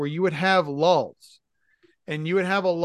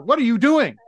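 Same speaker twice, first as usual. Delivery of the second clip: louder, higher scolding shout; imitated yell; 8 semitones higher, 10 dB louder than usual. Manager catching the grill cooks taking a break, yelling. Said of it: "she comes out and she yells".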